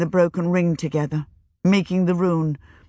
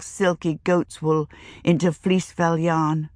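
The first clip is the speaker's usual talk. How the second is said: plain reading voice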